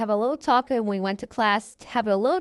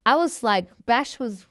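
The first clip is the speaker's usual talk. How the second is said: usual speaking voice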